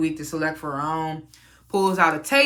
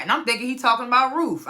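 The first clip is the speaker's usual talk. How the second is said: usual speaking voice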